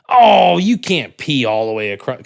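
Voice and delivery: loud, high mock disbelief; hammed-up gleeful agent; an agent sure he has won; gleeful scoff.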